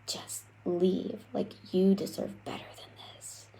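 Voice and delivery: soft breathy whisper of an inner voice, a little quick. Acted. The inner voice urging you when you're down, whispered. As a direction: whisper it like a gentle nudge inside your head. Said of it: "that voice creeps up and it's like".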